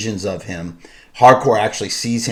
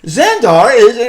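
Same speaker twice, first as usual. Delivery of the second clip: mock high-pitched quote; 10 semitones higher, 8 dB louder, slower than usual. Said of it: "and he's like".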